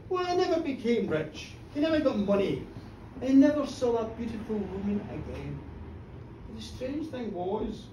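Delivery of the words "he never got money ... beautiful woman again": plain reading voice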